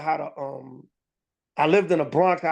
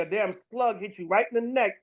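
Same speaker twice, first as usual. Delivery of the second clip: ordinary talk, nothing marked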